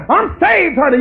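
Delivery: high, sing-song mock of a smug believer; mock churchgoer. Preacher mocking a churchgoer who claims to be saved, in a high sing-song voice. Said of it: "tell you to tell people".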